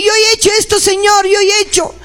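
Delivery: earnest acted prayer of repentance; fervent prayer. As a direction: pray it out as a guilty church president. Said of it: "Llora cuando tu hermano se disciplina".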